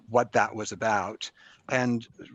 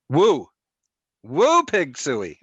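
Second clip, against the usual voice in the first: hog call cheer; 5 semitones higher, 9 dB louder, slower than usual. Retold or imitated. Arkansas Razorback hog call.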